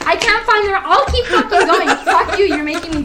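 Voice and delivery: high, loud comic exasperation; mock outrage. Host protesting to the friend teasing them, mock outrage. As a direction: protest loudly, half amused.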